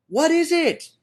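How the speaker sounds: high, loud astonished question; amazed crowd. Acted. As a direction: cry out in wonder at the strange tree. Crowd sees the tree.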